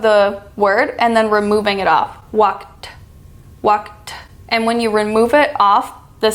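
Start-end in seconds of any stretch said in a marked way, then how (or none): 2.3-4.1 "Walked" ending in a crisp "t"; "Walked" with the tongue pulled off for a sharp "t". Shown as a demonstration. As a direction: snap the tongue away at the end of "Walked". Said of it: "I pronounce walked by taking the tip".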